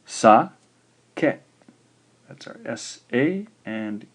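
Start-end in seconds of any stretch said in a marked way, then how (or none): 0.1-1.4 loud, high Sa, pause, ke; syllable by syllable. Shown as a demonstration. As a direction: split Sa-ke into two clear beats. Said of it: "here's the answer".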